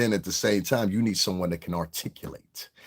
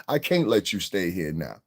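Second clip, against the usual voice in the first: ordinary delivery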